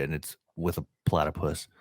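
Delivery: usual speaking voice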